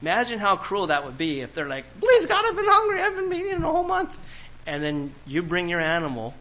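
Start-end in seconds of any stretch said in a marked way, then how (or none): 2.0-4.2 very high, loud, whining put-on voice; mock whiny priest. Preacher voicing a starving priest smelling barbecue, high whiny plea. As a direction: beg God like a starving comic priest. Hungry priest begging God.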